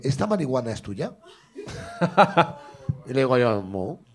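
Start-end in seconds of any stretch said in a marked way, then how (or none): 0.0-1.1 suspicious policeman. Retold or imitated. Policeman sniffing bag, retold. 3.6-4.0 very low, soft "no"; tiny guilty denial, dropped right down; sheepish denial. Retold or imitated. Sheepish denial to policeman, retold.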